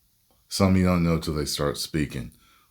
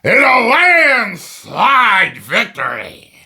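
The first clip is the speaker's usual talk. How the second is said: booming, drawn-out ring announcer, much higher and louder; wrestling promo announcer; 13 dB louder, much slower than usual. Acted. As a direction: stretch every word like hyping a main event. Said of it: "He could have been helping promote".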